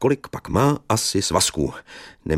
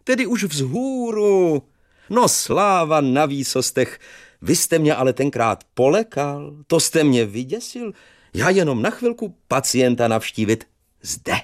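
put-on cheerful old teacher; 9 semitones higher than usual. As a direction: chatter fondly like a relieved old teacher. Teacher visiting sick pupil.

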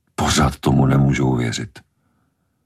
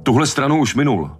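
very high-pitched call; excited cry in the thick of action; urgent shout; 11 semitones higher than usual. A narrator's reading. Shouting during the shark attack.

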